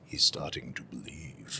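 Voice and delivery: low, slow quoted line; a hint of the film mentor's hushed awe; movie quote. Quoting the Matrix mentor, low and slow. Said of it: "you get a bit of".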